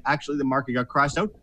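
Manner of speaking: ordinary talk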